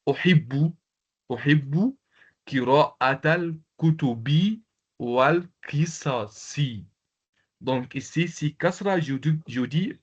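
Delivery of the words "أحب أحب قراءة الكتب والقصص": careful slow reading. Shown as a demonstration. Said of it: "donc je dis".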